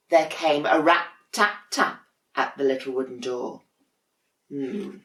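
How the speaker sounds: ordinary delivery, nothing marked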